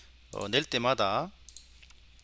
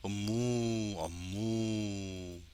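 long moo with a short closed-lip onset; cow moo imitation; 3 semitones lower, 6 dB quieter, much slower than usual. Shown as a demonstration. Teacher varying the cow samples, imitated mooing. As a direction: moo like a cow in a varied pattern. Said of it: "좀 패턴이 다르게".

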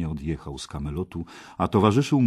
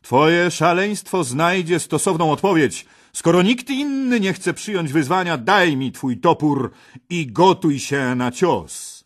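loud, much higher royal defiance; King Arthur angrily accepting the challenge, loud and higher; angry king; 11 semitones higher, 8 dB louder than usual. A narrator's reading.

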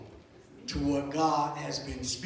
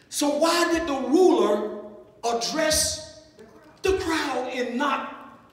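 high, raised pulpit voice; emphatic preaching; 7 semitones higher, 7 dB louder than usual. Real, not acted. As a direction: preach the verse forcefully, pausing between phrases. Preaches and reads scripture forcefully.

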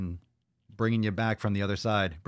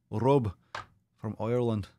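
hammed-up Irish accent; bad Irish accent. Streamer greets a viewer from Ireland, put-on Irish accent. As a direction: attempt an Irish brogue, badly. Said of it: "I'm apologize if that accent sucked".

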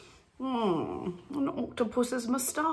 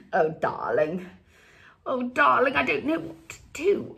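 affected British darling, rising higher; British accent; 3 semitones higher than usual. Acted. Fashion darling at a loss, British accent. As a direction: slip into a theatrical British accent. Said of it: "see, now I'm British".

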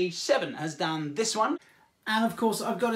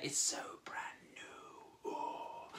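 hushed, breathy whisper of wonder; awed whisper; 16 dB quieter than usual. Acted. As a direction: whisper it, savouring each word. Marvels at the new wand.

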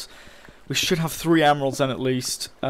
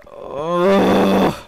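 loud, raised-pitch ugh; drawn-out growing groan of annoyance; frustrated groan; 6 semitones higher, 7 dB louder than usual. Real, not acted. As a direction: groan out real exasperation. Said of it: "so fucking pissed off".

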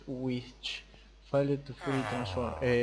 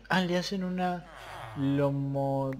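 normal talking voice

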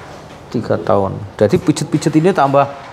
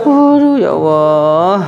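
slow, louder drawn-out groan of dismay; long slow pained exclamation; 4 dB louder, much slower than usual. Real, not acted. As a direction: stretch it out in dismay. Reacting to patient's pain.

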